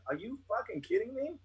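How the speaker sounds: high-pitched exasperated disbelief; incredulous. Acted. Reacting to questions put to a sitting president, high-pitched incredulity. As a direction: rise up in disbelief without shouting.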